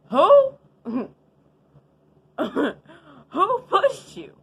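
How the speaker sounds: shrieking high and loud, breaking into a laugh; shocked disbelief. Retold or imitated. Accused by her friend, a shocked high laughing shriek. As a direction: squeal it in total shock. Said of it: "'Cause I was just shook".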